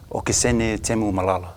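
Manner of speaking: usual speaking voice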